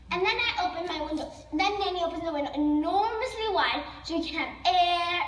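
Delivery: usual speaking voice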